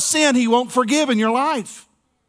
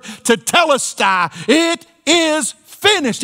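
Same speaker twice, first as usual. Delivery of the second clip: slow ringing cry; 6 semitones higher, slower than usual. Retold or imitated. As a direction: call it out slow and high, rising to a peak. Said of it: "he yells out this word".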